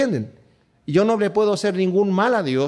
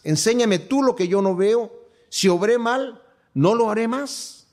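lectura bíblica solemne; 5 semitones higher than usual. Acted. Reads scripture aloud, raised. (lectura solemne y pausada de las Escrituras)

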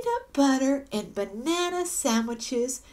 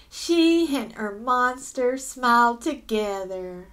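usual speaking voice

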